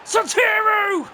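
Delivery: yelling with joy at the goal; ecstatic shout. Real, not acted. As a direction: celebrate the late winner at full voice. Late winner goal celebration.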